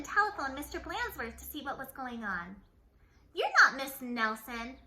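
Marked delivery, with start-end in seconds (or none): 3.3-4.8 slow, loud stern retort; loud gruff accusation. A narrator's reading. Principal on the phone refusing her, loud. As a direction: bark it at the caller. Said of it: "said Mister Blansworth".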